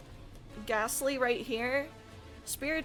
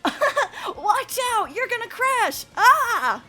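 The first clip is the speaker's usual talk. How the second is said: high laughing warning shout; laughing mock yell; 9 semitones higher, 8 dB louder, much slower than usual. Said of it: "It was".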